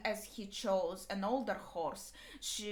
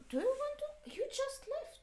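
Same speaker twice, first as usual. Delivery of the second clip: high, soft voice for a pet; high pet-talk voice; 5 dB quieter than usual. Real, not acted. Aside to someone off camera.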